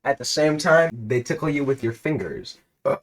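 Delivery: measured storyteller read of a game scene; reading out the game's story to a friend, narrator voice; story narration. A narrator's reading. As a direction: narrate the adventure steadily.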